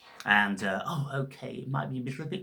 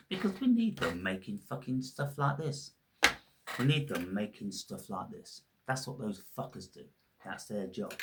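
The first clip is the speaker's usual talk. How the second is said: ordinary talk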